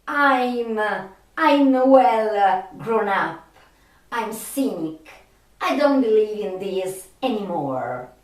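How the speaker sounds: usual speaking voice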